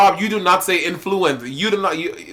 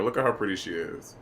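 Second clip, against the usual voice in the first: soft cooing; 8 semitones lower, 11 dB quieter than usual. Real, not acted. Admiring herself.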